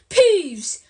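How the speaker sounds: loud furious call upward; angry yell. A narrator's reading. Teacher yelling at a poltergeist, angry and loud. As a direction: yell his name angrily. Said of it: "yelled an angry voice".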